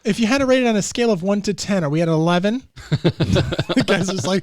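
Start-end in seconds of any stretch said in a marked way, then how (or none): 0.0-2.6 loud mock survey. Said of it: "I was like".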